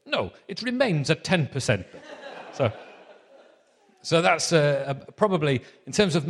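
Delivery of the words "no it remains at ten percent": higher-pitched, emphatic German professor; mock German accent with a high, falling no; comedy German accent. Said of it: "He said".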